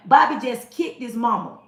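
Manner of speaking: ordinary delivery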